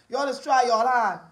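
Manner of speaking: ordinary delivery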